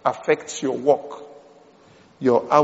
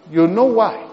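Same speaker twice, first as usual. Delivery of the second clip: normal talking voice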